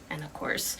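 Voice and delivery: ordinary delivery, nothing marked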